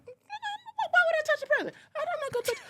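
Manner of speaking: high, laughing, tearful protest; high laughing disbelief. Retold or imitated. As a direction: squeal through laughter. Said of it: "I'm like".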